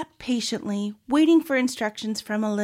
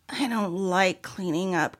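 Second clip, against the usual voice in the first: put-on grumbling little boy, slightly low; understated whiny grumble; complaining; 3 semitones lower than usual. A narrator's reading. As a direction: complain about a chore. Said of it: "complained Oliver".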